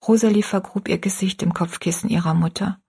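ordinary delivery

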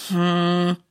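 low, drawn-out grumbling "hm"; sulky low hum; grumbled hum. A narrator's reading. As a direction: grumble wordlessly, low in pitch. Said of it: "brummt Silas nur".

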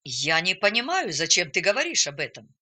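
usual speaking voice

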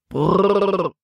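rolled, trilled "brrr" to stop horses; horse-halting call. A narrator's reading. Paul reins in his horses by the bridge, trilled halting call. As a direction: trill a long brrr at the team. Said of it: "rief Paul den Pferden zu".

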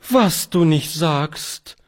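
high, slow, amazed cry; astonished exclamation. Acted. Charlotte hears about the little granddaughter, astonished slow cry. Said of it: "rief Charlotte und sank vor Erstaunen".

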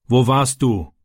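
mildly irritated father; a touch of annoyance in a short question. A narrator's reading. Stepfather questions the boy by the car, mildly irritated. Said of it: "fragte sein Vater gereizt".

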